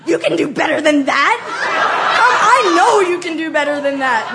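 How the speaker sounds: high, shouted goading; loud taunting. Retold or imitated. Scarer demanding a bigger scream from the star, taunting shout. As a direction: egg them on at full voice. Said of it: "screamed at the top of her lungs, and I was like".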